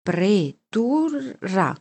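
very slow, syllable by syllable; reading out. A narrator's reading. Boy reading a word out, slowly and syllable by syllable. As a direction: spell out each syllable of the sign. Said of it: "lessi io".

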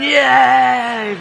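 loud, high imitated cheer; crowd cheering. People of Berlin cheer the speech, mock high cheering. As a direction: whoop like a delighted crowd. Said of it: "people in Berlin must have gone".

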